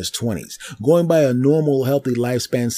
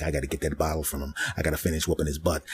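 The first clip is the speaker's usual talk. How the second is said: inner thoughts; 8 semitones lower, 10 dB quieter, faster than usual. Retold or imitated.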